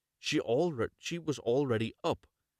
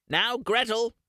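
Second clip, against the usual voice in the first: much higher, louder witch call; witch crying out; 12 semitones higher, 6 dB louder than usual. Acted. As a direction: snap the girl to attention. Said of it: "she cried to the girl".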